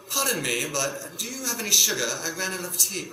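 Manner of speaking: polite British neighbour, lightly done; a light posh British accent asking a favour. Retold or imitated. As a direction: ask for sugar in a prim British accent. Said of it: "speak in a British accent".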